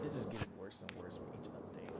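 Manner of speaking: low, soft whisper; almost to yourself, worn down; defeated whisper. Real, not acted. Gamer laments each update in a defeated whisper.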